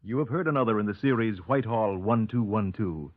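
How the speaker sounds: usual speaking voice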